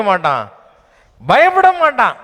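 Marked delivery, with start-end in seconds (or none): 1.3-2.2 much higher, loud ring; preaching shout. Real, not acted. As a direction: cry it out triumphantly. Climax shout in sermon. (உயர்ந்த உரத்த முழக்கம்)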